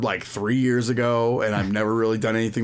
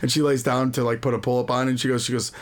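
normal talking voice

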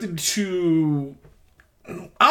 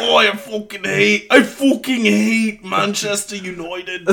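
put-on Cockney accent, sour football fan; hammed-up sour Cockney; Cockney soccer hooligan. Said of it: "he's fucking Cockney, like, just sour soccer hooligan".

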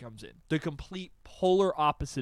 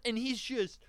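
ordinary talk, nothing marked

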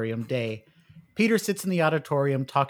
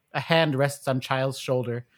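ordinary talk, nothing marked

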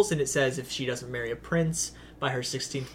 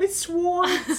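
high laughing quote. Retold or imitated. Repeating the Genie quote.

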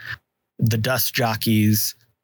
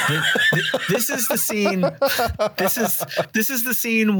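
laughing; 11 semitones higher than usual. Real, not acted. Laughing at the comparison.

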